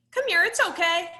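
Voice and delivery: put-on high, gentle comfort for a crying child; soothing. Shown as a demonstration. Teacher comforting a crying pushed boy, high and soothing. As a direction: coax the child over kindly.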